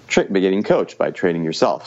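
ordinary talk, nothing marked

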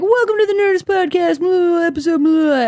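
high, loud radio host intro; podcast host impression. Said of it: "He'd always be like".

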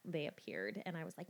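ordinary talk, nothing marked

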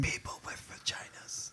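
hushed whisper. Real, not acted. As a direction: lean in and whisper it. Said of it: "The way you whispered it at me".

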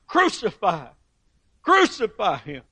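high crowd cry of crucify; a lightly voiced angry crowd; crowd demanding. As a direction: cry it out like a turned mob. Crowd turns against Jesus.

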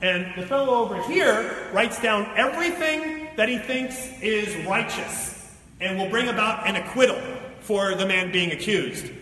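ordinary talk, nothing marked

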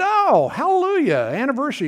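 high, bright hallelujah; delighted surprise. Retold or imitated. Uncle greeting his nephew carrying roses, delighted and high. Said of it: "And I said".

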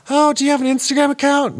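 high, fast mocking voice; mocking imitation. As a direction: ham up the nosy questioner. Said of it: "they look at you like".

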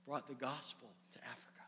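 hushed whisper of awe; a reverent whisper for the big reveal. Real, not acted. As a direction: breathe it out in a whisper. Hushed emphasis in sermon.

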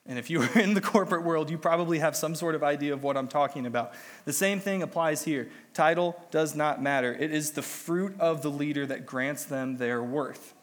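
usual speaking voice

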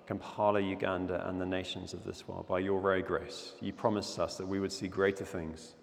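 low, hushed prayer voice; quiet earnest congregational prayer. Acted. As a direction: pray softly over the nation. Opening prayer before the sermon.

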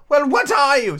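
high, loud demand; challenging pigeon. Acted. Pigeon questioning Alice, high and challenging. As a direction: demand it in a bird screech.

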